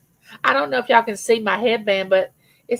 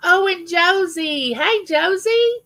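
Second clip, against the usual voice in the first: high, slow sing-song hello; delighted greeting; 10 semitones higher, slower than usual. Real, not acted. Welcoming a viewer who just joined, high sing-song greeting.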